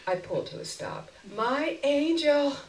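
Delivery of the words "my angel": very slow, higher, drawn-out gratitude; sweet thanks. Acted. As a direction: stretch it out sweetly. Slow grateful farewell.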